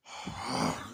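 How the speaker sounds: voiceless, put-on sigh of boredom. Girlfriend stuck in class, imitated bored sigh. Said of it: "getting bored or whatever".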